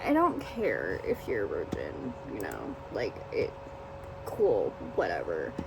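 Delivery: low, soft throwaway; dismissive aside. Real, not acted.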